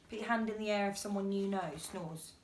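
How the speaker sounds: plain reading voice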